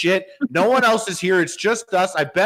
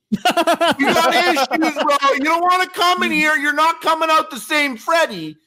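high mocking taunt; 11 semitones higher than usual. Taunting Freddie in a put-on voice.

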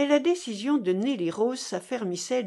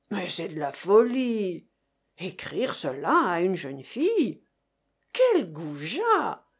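indignant exclamations. A narrator's reading.

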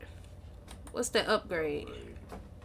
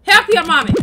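normal talking voice

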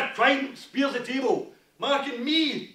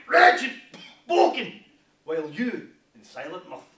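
ordinary talk

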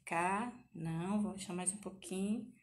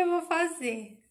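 loud and higher, slow and emphatic; loud playful retort; 8 semitones higher, 9 dB louder, slower than usual. Retold or imitated. Answering her friend Vivi with a loud playful promise. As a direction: belt it out as a teasing promise.

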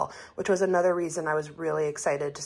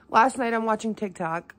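ordinary talk, nothing marked

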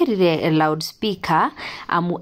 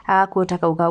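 normal talking voice

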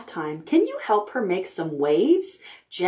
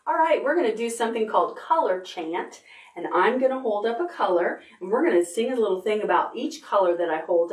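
fast, eager; faster than usual. Real, not acted. Quick setup for a song.

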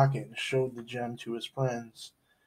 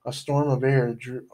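ordinary delivery

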